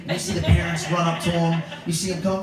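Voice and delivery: usual speaking voice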